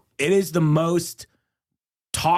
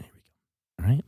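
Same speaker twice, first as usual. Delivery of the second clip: breathy ASMR whisper into the mic; hushed ASMR voice; 5 dB quieter than usual. Pretend. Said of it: "our world famous A S M R minute".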